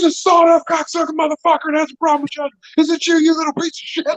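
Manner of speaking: high, fast, hammed-up tirade at the trainee; mock furious yell. Bob storming round the corner at the kid, high mock yell. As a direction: bellow it like a raging bully. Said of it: "He turns that corner and goes".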